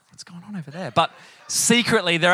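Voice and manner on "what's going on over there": very soft aside; feigned disapproval. Acted. Parent pretending to other parents the kids aren't theirs, hushed aside. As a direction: confide it quietly to a fellow onlooker. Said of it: "you're looking at other parents like".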